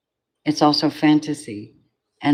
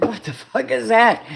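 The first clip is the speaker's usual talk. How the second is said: mock shocked outburst; 8 semitones higher than usual. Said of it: "I'm like".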